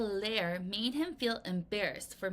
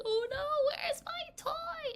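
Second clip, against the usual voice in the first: put-on much higher, breathy whisper of a distraught little girl; mock distressed girl. Girl who lost her favorite toy, mock upset high whisper. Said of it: "She's like".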